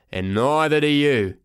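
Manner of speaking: slow, gruff retort; growling official; cross porter. Acted. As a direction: say it heavily, glaring down at a kid.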